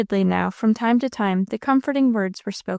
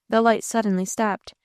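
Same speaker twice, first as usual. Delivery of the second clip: ordinary delivery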